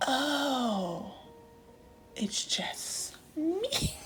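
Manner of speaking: high, drawn-out squeak on the last word; retold realization breaking into laughter; dawning realization. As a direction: act out finding you're the odd one. Retold realization she is different.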